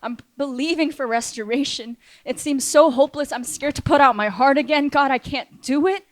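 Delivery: acting out a despairing prayer; desperate plea. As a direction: cry out as if overwhelmed. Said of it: "I'm like".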